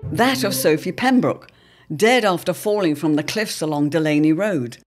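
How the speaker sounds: ordinary talk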